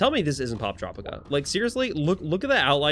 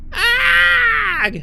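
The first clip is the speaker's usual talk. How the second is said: high, loud yell; loud scream; 11 dB louder than usual. Acted. Acted cry in game story.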